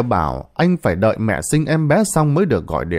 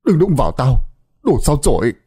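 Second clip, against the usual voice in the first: put-on a raised, harsh drunken yell; loud drunk shout; 2 semitones higher, faster than usual. A narrator's reading. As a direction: shove her away with your voice. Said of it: "lớn tiếng nói". (quát lớn trong cơn say)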